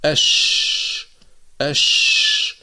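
أش hissed out long and unvoiced; أش بنفخ الهواء; shin demo. Shown as a demonstration. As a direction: let the air spread on أش. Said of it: "فلما نقول".

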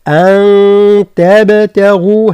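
slow أن تبتغوا with the nun hidden in a nasal hum; nasal ikhfa. Shown as a demonstration. Teacher models ikhfa for students. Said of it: "هكذا".

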